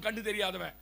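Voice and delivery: ordinary delivery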